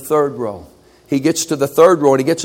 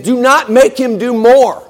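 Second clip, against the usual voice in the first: higher and louder demand; angry plea; 8 semitones higher, 5 dB louder than usual. Acted. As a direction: insist it stop now. Angry students plead.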